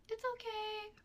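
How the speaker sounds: high, forced little okay; high-pitched, uneasy politeness; awkward reassurance. Retold or imitated. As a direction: reassure him without meaning it. Awkward reassurance after his apology.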